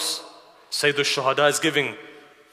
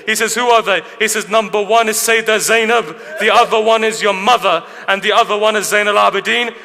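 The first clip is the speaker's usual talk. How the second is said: loud, high impassioned majlis preaching; preaching at full force; 6 semitones higher, 7 dB louder than usual. Acted. Preacher narrating Karbala to mourners, impassioned and loud. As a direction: drive the mourners toward tears.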